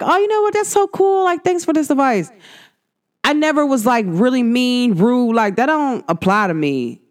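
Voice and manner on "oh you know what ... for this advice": high-pitched, people-pleasing thanks; polite agreeableness. Retold or imitated. Former self thanking someone for unwanted advice, polite agreeableness. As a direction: accept it in a high, over-agreeable voice. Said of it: "I used to be like".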